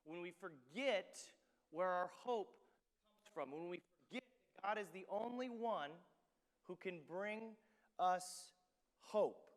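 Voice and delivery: loud, emphatic sermon climax; loud, slowed words hammered home; full-force preaching. Acted. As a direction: drive each phrase home to the congregation.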